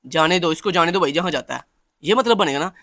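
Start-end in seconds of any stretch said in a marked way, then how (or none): none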